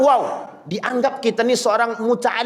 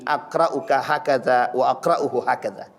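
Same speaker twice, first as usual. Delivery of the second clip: calm recitation; 10 semitones lower than usual. Retold or imitated. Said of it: "Kata Nabi".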